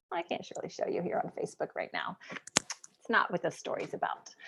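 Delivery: near-whisper, very soft and low; hushed aside. Real, not acted. Telling viewers she can't show it, hushed aside. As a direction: drop to a confidential hush.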